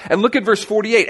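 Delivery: normal talking voice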